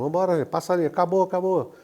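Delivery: retold hurry-up call. Official to parade members, hurrying them. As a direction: imitate someone hurrying the paraders along. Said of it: "o cara falou".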